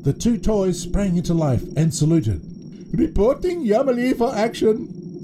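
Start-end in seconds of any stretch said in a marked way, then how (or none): 2.9-5.2 very high, eager hippo voice; high-pitched cartoon salute; toy hippo character. A narrator's reading. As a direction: report for duty as a comic toy. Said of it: "said Mister Hippo".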